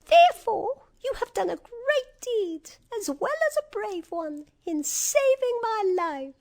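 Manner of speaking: high-pitched little royal mouse; squeaky mouse queen. A narrator's reading. Mouse queen honours her rescuer, high squeaky voice. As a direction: pronounce it grandly in a tiny squeak. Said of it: "added the queen".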